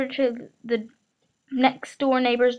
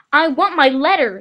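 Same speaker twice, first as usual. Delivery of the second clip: louder, higher shout; 4 semitones higher, 8 dB louder than usual. A narrator's reading. Boy shouting at his uncle for his letter. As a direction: shout the demand. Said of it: "he shouted".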